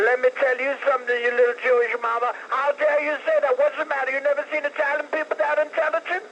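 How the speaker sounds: ordinary talk, nothing marked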